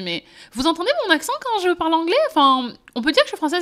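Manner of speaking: high, loud retold disbelief; incredulous question. Asking the crew about her French accent, incredulous.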